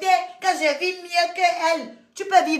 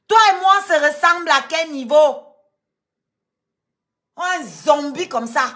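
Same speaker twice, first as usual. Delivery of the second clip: ordinary talk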